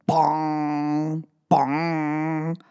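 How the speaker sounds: low, ringing clock bong; clock chime. Pretend. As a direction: ring it out like a big clock.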